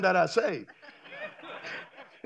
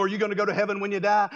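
mock rote question; 3 semitones lower, faster than usual. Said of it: "We stop asking people".

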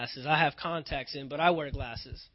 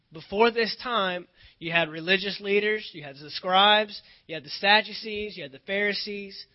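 preaching build; 3 semitones higher, 7 dB louder than usual. Acted.

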